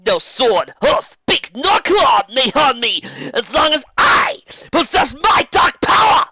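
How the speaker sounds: high, loud, slow evil enchantress boom; echoing villain voice filling a cavern. A narrator's reading. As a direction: roar it down from on high like a sorceress. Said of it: "said Torka, her voice reverberating".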